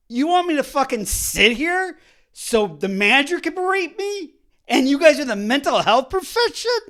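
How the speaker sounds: angry retort. Retold or imitated. Angry reply to nurse.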